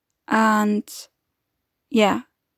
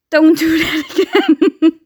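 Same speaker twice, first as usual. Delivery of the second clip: louder, slow, higher telling-off breaking into laughter; laughing scolding; 5 semitones higher, 5 dB louder than usual. Real, not acted. Telling Ethan the cat off, laughing. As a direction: scold the cat while cracking up.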